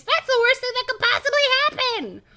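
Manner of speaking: high, loud cartoon alarm; exclaiming. A narrator's reading. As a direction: cry out in overblown horror. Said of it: "Professor Shock exclaims".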